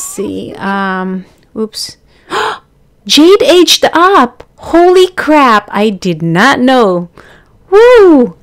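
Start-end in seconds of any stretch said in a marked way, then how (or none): 2.3-5.7 gasps, then high and loud, slow with shock; surprised gasp. Real, not acted. Player spotting her Sim aged up, gasping surprised. 7.7-8.4 high loud whoop; cheer. Real, not acted. Player celebrating her new Sim, loud whoop.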